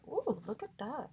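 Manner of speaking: usual speaking voice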